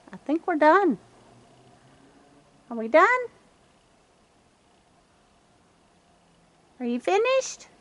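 high, loud call into the yard. Real, not acted. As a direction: call out to see who is left. Calling to raccoons after feeding.

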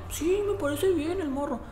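lightly voiced high, meek teen; meek kid. The tamed boy answering the officer, meek and high. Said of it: "Ya el morro andaba más mansito".

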